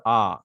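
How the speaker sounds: low "Ah" with the r left out; "Ah" ending on the open vowel; silent R. Shown as a demonstration. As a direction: say a plain open "Ah", no r. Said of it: "you won't get again this sound".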